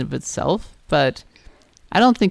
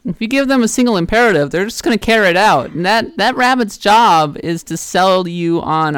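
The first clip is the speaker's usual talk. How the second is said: ordinary talk, nothing marked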